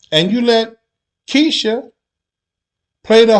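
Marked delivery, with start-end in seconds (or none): none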